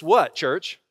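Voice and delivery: ordinary talk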